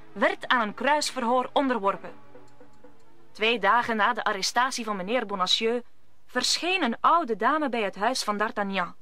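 ordinary delivery

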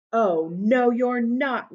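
loud roaring troll. Acted. Troll roaring at the goat, loud and angry. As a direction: roar it back like an angry troll. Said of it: "roared the troll".

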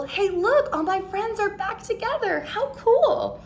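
put-on high, bright-eyed kid spotting his friends, laughing; excited kid. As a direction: squeal it out like a thrilled kid.

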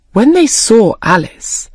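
usual speaking voice

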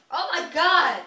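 loud, high disgusted cry; grossed-out shriek. Real, not acted. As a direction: yelp at the mess.

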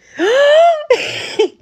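high, loud theatrical gasp of shock; mock gasp. Reacting to the Anderson clue with a big mock gasp. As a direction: gasp like a scandalized auntie.

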